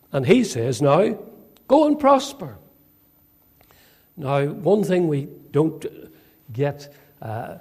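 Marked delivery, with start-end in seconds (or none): 1.7-2.6 high-pitched mock encouragement; sarcastic send-off, pitched high then falling. Prophet answering the king about the battle, sarcastic.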